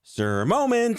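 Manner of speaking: high, bright segment announcement; announcer voice. Acted.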